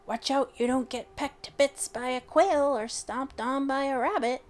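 a fierce little woman warning the bear. A narrator's reading. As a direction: snap a stern warning at him. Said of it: "the fierce little woman who had warned him".

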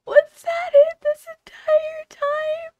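very high, teary, half-laughing squeal; laugh-crying disbelief. Real, not acted. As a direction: disbelief cracking into laughter and tears, slow. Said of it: "Oh, I'm gonna cry".

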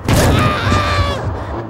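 very high startled scream. Acted. Camper's escape goes wrong, high scream. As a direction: shriek high in alarm.

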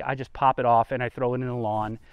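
usual speaking voice

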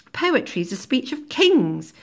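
high, loud, confident proclamation; assured, admonishing teacher. Acted. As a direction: lay down the law as a teacher. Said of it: "it's very clipped, very assured, very confident".

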